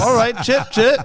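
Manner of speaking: hammed-up cheery British sign-off; mock British sign-off on a pretend call; chipper British. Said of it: "British or whatever accent".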